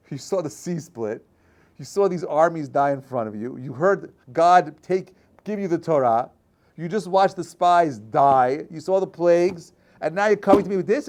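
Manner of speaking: loud, high, incredulous scolding; teacher scolding ungrateful people, loud and high; exasperated rebuke. Acted.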